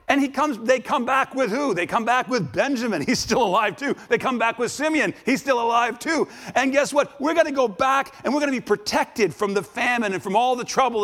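high, driving sermon delivery; excited preaching. Acted. Preacher retelling the brothers' joyful return, excited.

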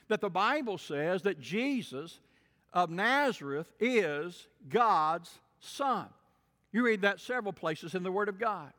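ordinary delivery

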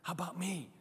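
hushed, scheming whisper-like aside; subtle sly murmur. Pretend. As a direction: slip it in softly like a secret pitch. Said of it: "that small little subtle".

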